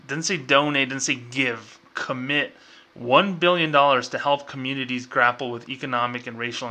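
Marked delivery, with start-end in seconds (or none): none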